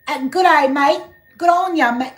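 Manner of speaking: high, loud mock Aussie greeting; accidental Australian accent. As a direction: overdo an Australian mate-to-mate hello. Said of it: "Oh, that's Australia".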